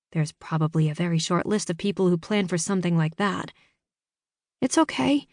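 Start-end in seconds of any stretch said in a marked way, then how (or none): 4.6-5.3 put-on higher young girl voice; a teenage girl holding back tears. A narrator's reading.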